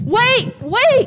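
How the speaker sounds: loud, high, urgent shouts; calling out. Acted. Client shouting after the departing fortune teller, urgent call. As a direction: shout after someone to stop them.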